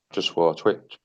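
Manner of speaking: a hushed, confessing side note; whispered aside. Real, not acted. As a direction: whisper it to the chat as a quick aside. Aside to stream about swearing.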